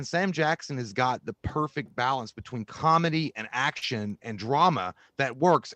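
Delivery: ordinary talk, nothing marked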